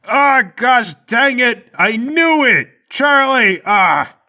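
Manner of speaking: high, drawn-out howl of frustration; anguished yell. Acted. Mike realising he was tricked by Charlie, frustrated yelling. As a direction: wail loudly at your useless partner.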